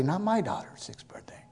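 hushed under the breath; whispered aside. Acted. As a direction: drop to a secret whisper.

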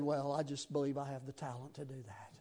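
low, soft, smug-modest reply; lightly imitated low-voiced confidence; self-assured classmate. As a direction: answer quietly, sure of your gift.

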